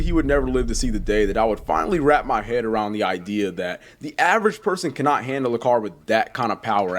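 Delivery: normal talking voice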